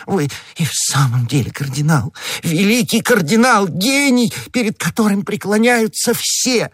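high-pitched gushing praise; grovelling adulation. A narrator's reading. Bonacieux at the cardinal's feet calling him a genius, gushing adulation. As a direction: flatter him in hammed-up rapture. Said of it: "бросаясь к ногам его высокопреосвященства".

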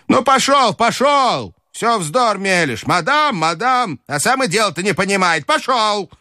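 put-on high-pitched scolding old lady; impatient grandmother. A narrator's reading. Grandmother dismissing the prattling Frenchman, high and impatient. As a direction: lose patience and shoo him off in a high voice. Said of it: "Бабушка потеряла, наконец, терпение".

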